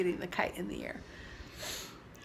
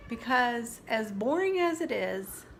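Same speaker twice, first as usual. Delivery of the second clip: sincere whisper; 3 semitones higher than usual. Real, not acted. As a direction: confide it gently, barely voiced. Sincere confession about her purpose.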